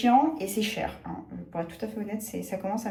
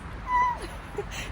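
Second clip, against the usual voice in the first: high squeal of a laugh; squeaky delighted laugh; 3 dB quieter than usual. Real, not acted. Thrilled about her new Pax, a high squealing laugh. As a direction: let out a little delighted squeal.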